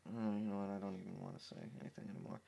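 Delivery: exasperated mumble. Real, not acted.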